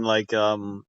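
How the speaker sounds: ordinary talk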